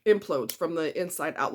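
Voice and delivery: ordinary delivery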